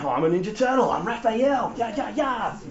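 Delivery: lightly voiced weird schoolkid; weird kid. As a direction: play the kid claiming to be a cartoon hero. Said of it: "the weirdest kid at school coming to school and saying".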